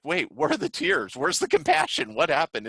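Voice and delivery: mock stunned outrage, climbing high; retold shocked disbelief. Fired employee stunned by Norm's coldness, asking in high disbelief. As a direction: pitch jumps way up on where's the compassion. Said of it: "the person's like".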